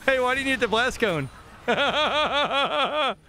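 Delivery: gloating, repeated jeer broken by cackles; mocking taunt. Real, not acted. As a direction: taunt them gleefully, pitched well up. Mocks opponent's missed escape, laughing.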